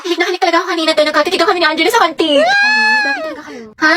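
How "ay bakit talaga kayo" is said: high startled shout. Acted. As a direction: blurt it out in surprise.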